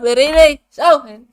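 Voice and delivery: complaining loudly, insisting it hit; frustrated outburst. Real, not acted. Man complaining the payment won't show, frustrated and loud. Money not showing.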